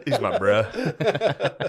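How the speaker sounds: slow laughing quote. Retold or imitated. Joking repeat of quote.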